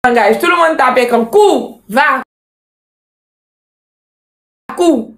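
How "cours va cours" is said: urgent yelling. Real, not acted. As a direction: yell at them to keep running. Repeatedly urging partner to run. (crier d'urgence, aigu et fort)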